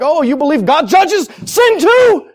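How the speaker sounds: high, slowed confrontational shout; mock yell. Preacher imagines answering someone with a sticker, yelling. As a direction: holler it out, drawing out the words. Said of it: "yelling at people like that".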